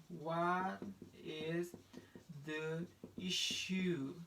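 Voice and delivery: slow, separated words with clear gaps; word-by-word modelling. Shown as a demonstration. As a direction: pause after each word and stretch it for learners.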